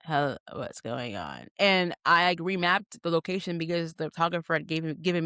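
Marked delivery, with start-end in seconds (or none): 0.0-1.5 much lower, softer and slower; puzzled, under the breath; confused low mutter. Retold or imitated. Dropped off at an intersection, confused low murmur. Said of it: "And I'm like".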